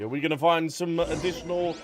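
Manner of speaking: normal talking voice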